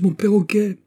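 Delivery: put-on very high, distressed child's cry. A narrator's reading. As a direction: cry out for a lost pet. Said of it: "criai-je".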